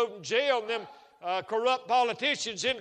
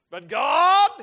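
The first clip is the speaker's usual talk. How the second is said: "but God" much louder and higher, rising; climactic "but God"; 6 semitones higher, 15 dB louder than usual. Shown as a demonstration. Preacher building to a climax for the congregation, ringing "but God". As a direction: lift "God" into a ringing cry. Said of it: "repeated, building".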